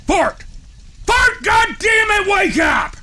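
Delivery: high-pitched, exasperated yell; shouting him awake, fed up; angry wake-up shout. Acted. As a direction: holler to rouse a sleeping brother. Yelling to wake his sleeping brother.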